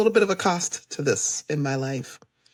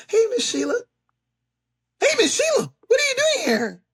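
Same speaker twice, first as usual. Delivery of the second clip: very high-pitched delighted surprise, imitated; surprised greeting. Friend looking up at her unexpected arrival, high surprised call.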